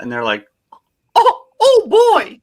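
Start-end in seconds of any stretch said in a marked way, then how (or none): 1.2-2.4 very high, loud cartoon mouse squeak; high-pitched, exclaiming mouse voice; Mickey Mouse impression. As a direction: leap into falsetto like a cartoon mouse. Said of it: "show off your good Mickey impression".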